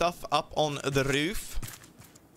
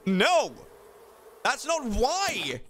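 mock indignant; 9 semitones higher, much slower than usual. Indignant denial of being short.

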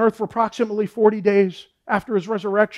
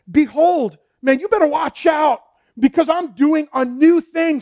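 raised, emphatic pulpit voice; impassioned preaching; 4 semitones higher than usual. Real, not acted. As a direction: preach it with fervor and drive. Preaches promise of coming victory.

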